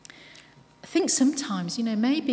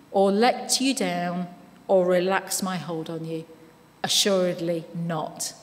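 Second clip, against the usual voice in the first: scripture reading. Acted. Reads Hebrews from the Amplified.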